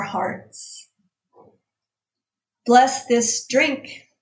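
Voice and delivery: slow, measured prayer voice with long pauses. Acted. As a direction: lead the prayer phrase by phrase for the congregation to repeat. Leads a spoken table prayer.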